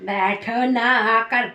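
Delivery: slow, low, drawn-out mimicry; mocking echo. Throwing the partner's invitation to sit back at her, mocking echo. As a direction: mimic the invitation, stretching each word low.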